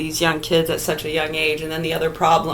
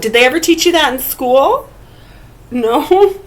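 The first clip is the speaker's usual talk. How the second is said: higher teasing mock question; 6 semitones higher, 3 dB louder than usual.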